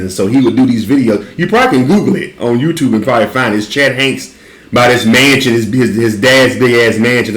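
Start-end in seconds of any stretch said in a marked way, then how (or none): none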